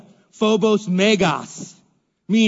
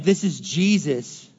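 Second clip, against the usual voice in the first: ordinary delivery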